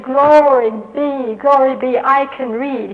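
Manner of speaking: loud, slow, jubilant praise; rejoicing. Retold or imitated. Elderly pupil rejoicing he can read, loud and slow. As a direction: cry out in glory.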